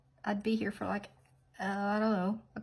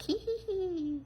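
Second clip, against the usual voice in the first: high little mock titter; impish giggle; 11 semitones higher, slower than usual. Little guy peeking out from the nail, impish giggle. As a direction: play a tiny creature's hee-hee. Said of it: "He's gonna be peeking out".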